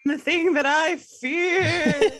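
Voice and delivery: slow, high mock-spooky wail with a laugh; playful ghost. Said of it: "I'm a ghost".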